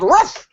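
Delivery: sudden high, yelped fake bark; yelled dog woof. Brunette hiding from cops in a basket, yelled fake bark. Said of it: "and the brunette yelled".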